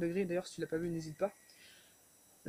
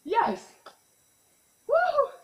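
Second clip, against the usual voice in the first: ecstatic shouted yes and whoop; triumphant cheer; 9 dB louder, much slower than usual. Real, not acted. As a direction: roar with victory. Reached a thousand push ups. (cri de victoire)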